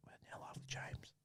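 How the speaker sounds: hushed reporter's whisper. Pretend. Reporter whispers to the audience that they are live. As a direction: whisper it like an on-air secret. Mock live news aside.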